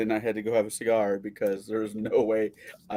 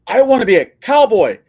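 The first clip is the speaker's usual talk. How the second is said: building from a whisper to a high loud cry; excited wish; 9 dB louder than usual. Real, not acted.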